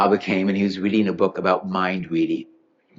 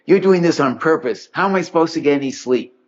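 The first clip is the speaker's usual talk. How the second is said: mildly upset complaint; 7 semitones higher than usual. Retold or imitated. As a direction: complain to Baba as if woken again. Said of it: "he's upset".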